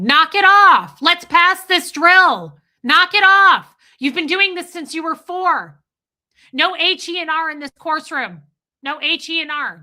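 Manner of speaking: yelling supervisor. Retold or imitated. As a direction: bark orders at a seven-year-old. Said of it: "an adult leaning over me going".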